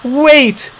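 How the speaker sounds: very high loud plea; desperate shout. A narrator's reading. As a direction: beg him to stop. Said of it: "begged Gaia".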